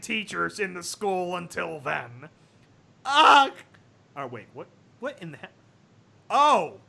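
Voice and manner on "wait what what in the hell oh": plain reading voice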